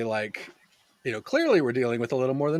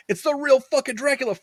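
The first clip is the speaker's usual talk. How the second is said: high, breathless fanboy gush; overacted thrill at meeting Dracula; mock starstruck excitement; 12 semitones higher, 6 dB louder than usual. Quoting character introducing Dracula.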